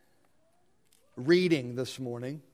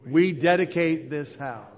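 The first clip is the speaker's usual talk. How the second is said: firm, louder congregational response; recited with gusto; 9 dB louder than usual. Acted. Congregation answers the reader to dedicate the church, with gusto. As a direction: declare it together, strong and measured. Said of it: "say it with gusto".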